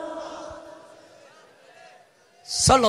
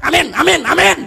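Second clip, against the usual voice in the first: rushed, loud, higher amens; mock impatient shout; 5 semitones higher, 10 dB louder than usual. Impatient youth at the back yelling amen to finish quickly. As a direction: hurry the prayer along with yelled amens. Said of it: "di belakang dia teriak".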